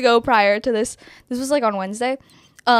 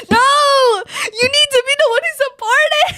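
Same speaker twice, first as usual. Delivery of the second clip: laugh-crying; 12 semitones higher than usual. Real, not acted. She wails at her friends' reaction, laughing. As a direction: cry the words out through helpless laughter.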